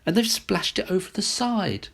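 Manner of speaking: a touch of a fussy mother; mother bear voice. A narrator's reading. Mother bear notes the spilled porridge, lightly voiced. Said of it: "said the mother bear in her middle-sized voice".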